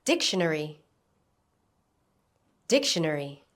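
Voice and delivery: Dictionary stressed on its first syllable, DIC. Shown as a demonstration. Teacher modelling a word for learners to repeat, first-syllable stress on "Dictionary". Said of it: "Notice the stress on the first syllable".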